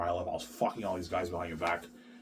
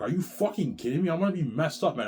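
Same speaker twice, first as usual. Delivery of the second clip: higher, louder incredulous outburst; outraged disbelief; 7 semitones higher, 3 dB louder than usual. Retold or imitated. Man recalling his shock at being forced into porn. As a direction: shout your disbelief at them. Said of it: "I'm like".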